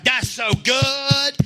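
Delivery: hammed-up adoring swoon, stretched out; mock gushing. Congregation reacting as if to Joel Osteen, mock gushing. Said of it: "If I was Joel Osteen right now, y'all be like".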